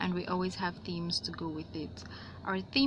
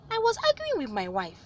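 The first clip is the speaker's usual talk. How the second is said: very high, raised spider voice; Anansi explaining; 12 semitones higher, 4 dB louder than usual. A narrator's reading. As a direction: explain it pitched way up. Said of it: "said Anansi".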